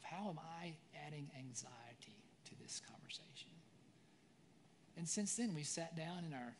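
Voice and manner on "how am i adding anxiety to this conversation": hushed whisper to himself; a soft, voiceless searching whisper. Real, not acted. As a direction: breathe the question out, no voice. Whispered self-examining question.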